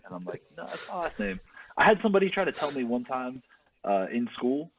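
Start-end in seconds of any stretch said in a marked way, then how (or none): 0.6-1.4 high, soft little protest; retold meek correction, pitched well up; mock protest. Insisting to people that the name is right, high soft protest. Defending own name to askers.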